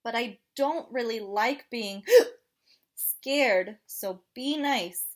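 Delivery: hiccupy penguin asking for help; gasping little penguin; penguin character voice. Acted. Penguin asks kids to scare.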